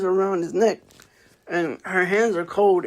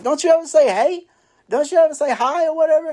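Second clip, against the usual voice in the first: higher, louder, sassy scold; scolding Libby; 9 semitones higher, 3 dB louder, faster than usual. Retold or imitated. Libby scolds the boy for never saying hi, higher and louder. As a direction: tell him off for skipping hello.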